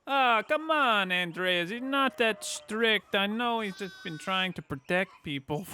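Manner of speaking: loud, high, hammed-up Italian accent; badly faked, stretched-out Italian accent; poor Italian accent. Player voicing a townsman who teases Andreas, poor Italian accent. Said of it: "try to do a poor Italian accent".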